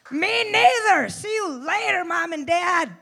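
high, loud mock send-off; mock gleeful shout. Comic jokes about ditching parents, mock gleeful shout. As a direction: shout it high across the room.

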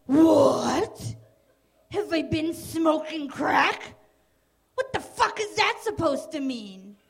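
mock offended outburst, loud opening; defensive dad. Dad answering his kid's crack question, overplayed defensiveness. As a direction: act accused and push back, overplayed. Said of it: "What are you so defensive about".

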